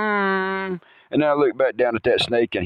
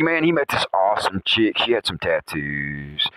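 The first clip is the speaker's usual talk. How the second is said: ordinary delivery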